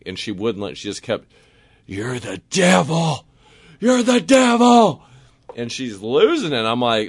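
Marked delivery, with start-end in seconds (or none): 1.9-3.3 slow, higher, drawn-out accusing voice; drunken accusation. Retold or imitated. Drunk older lady who won't let it go, mounting accusation. 3.8-5.0 high, loud, drawn-out wail; hammed-up slow shriek of accusation; mock screaming accusation. Drunk older lady repeatedly accusing a stranger, mock screaming. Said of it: "The lady's now screaming at me".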